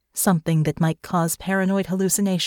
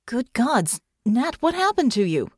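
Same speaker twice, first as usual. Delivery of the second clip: shocked concern; 6 semitones higher than usual. A narrator's reading. Irene shocked at Natasha's injuries.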